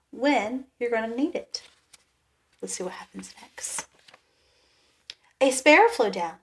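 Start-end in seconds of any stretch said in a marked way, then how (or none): 2.6-3.9 hushed aside to the listeners; whisper. Real, not acted. Reader whispers to children.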